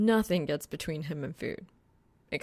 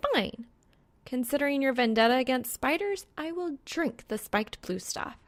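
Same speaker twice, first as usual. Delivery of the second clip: higher, lightly voiced young woman, dry and teasing; Reaper's voice; 7 semitones higher than usual. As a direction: play Reaper as a sly, knowing girl.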